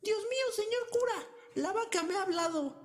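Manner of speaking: very high, agitated cry to the priest; flustered outburst. A narrator's reading. The cook rushes to tell the priest, panicked high cry. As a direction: blurt it out in a panic. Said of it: "le dijo alterado".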